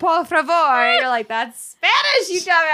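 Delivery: slow, drawn-out fake foreign phrasing; hammed-up bad accent; mock Italian attempt. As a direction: stretch out the tourist phrase. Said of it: "I guess goes".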